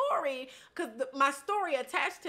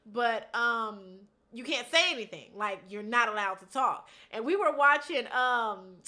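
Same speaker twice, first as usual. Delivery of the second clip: ordinary talk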